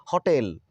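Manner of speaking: "होटेल" with open 'haw' and long 'tel'; correct pronunciation. Shown as a demonstration. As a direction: say "होटेल" as haw-TEL. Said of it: "होटेल बोलते हैं". ("होटेल" को 'हॉटेल' बोलें, हल्का खुला 'ऑ')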